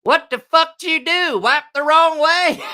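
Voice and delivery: high, loud yell of outrage; a friend roaring from the next bedroom in high, loud disgust; furious shout. Retold or imitated. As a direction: bellow it through the wall, high and loud. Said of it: "all of a sudden I hear him go".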